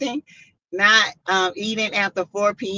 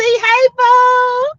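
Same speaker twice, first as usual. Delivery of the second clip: much higher and louder, gushing hello; excited greeting; 7 dB louder than usual. Real, not acted. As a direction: call out to a friend in chat. Greeting a viewer on livestream.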